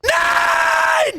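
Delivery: high, loud drawn-out scream. Real, not acted. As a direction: scream it in disbelief. Screaming at game loss.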